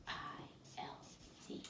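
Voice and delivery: softly whispered letters. Real, not acted. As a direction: whisper to yourself while writing. Whispers while writing.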